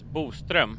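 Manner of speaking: Boström with the Swedish rounded ö, Buu-strim; Boström as a native Swede says it; Swedish pronunciation. Shown as a demonstration. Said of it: "the Swedish pronunciation of it is".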